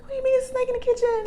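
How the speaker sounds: very high, rushed squeak of a question; retold freaked-out incredulity; panicked disbelief. Freaked-out mother questions calm group.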